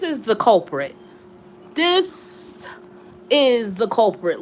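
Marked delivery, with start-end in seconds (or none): 1.7-2.7 high pointed this. Real, not acted. Introducing the culprit cat.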